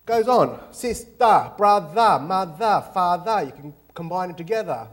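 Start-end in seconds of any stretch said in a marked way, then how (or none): 0.1-3.4 Sister, brother, mother, father with a loud open "uh" ending; Aussie -uh endings. Shown as a demonstration. Teaching a class Aussie word endings, loud drawn-out "uh". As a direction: drop the r, end each word on a broad "uh". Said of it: "everything's got a U H at the end".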